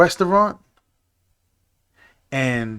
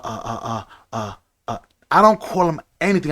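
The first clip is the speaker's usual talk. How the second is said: ordinary talk, nothing marked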